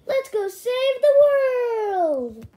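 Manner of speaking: high, loud, drawn-out shout; heroic rallying cry. Acted. Rallying Dog Man to save the world, heroic shout. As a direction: stretch it into a big battle cry.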